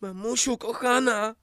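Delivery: high, slow cry for dear mummy. Acted. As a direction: stretch it out high.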